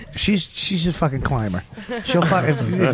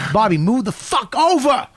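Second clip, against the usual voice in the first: higher, impatient retold command; angry order; 8 semitones higher than usual. Patrice orders Bobby to shift over, mock angry outburst. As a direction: snap it as a repeated order. Order repeated, acted quarrel.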